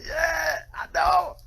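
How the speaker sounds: teasing sister back, high gleeful squeal; smug squeal. Retold or imitated. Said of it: "And I was like".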